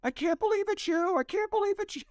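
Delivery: retold, overjoyed high-pitched cry; excited yelling. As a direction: squeal it like a starstruck fan. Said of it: "and yelling".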